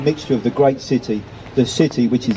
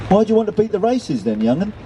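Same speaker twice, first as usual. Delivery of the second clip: higher-pitched curious question to a youngster; 9 semitones higher than usual. A narrator's reading. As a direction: ask it brightly pitched up, like a storybook gull. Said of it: "he asked the young pigeon".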